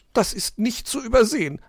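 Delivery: high, loud, sarcastic jab; mirthless. A narrator's reading. Magpie mocks the fox's messy shed, mirthless. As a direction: sneer without any real laugh. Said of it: "lachte die Elster freudlos".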